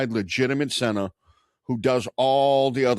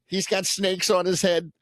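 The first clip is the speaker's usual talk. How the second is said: mock Boston rant; 8 semitones higher than usual. Joins the Boston impression.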